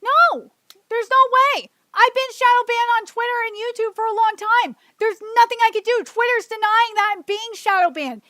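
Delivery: emotional, indignant outburst; pissed off, emotional. Real, not acted. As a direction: vent frustration, loud at first then easing slightly. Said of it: "I'm getting emotional 'cause I'm pissed".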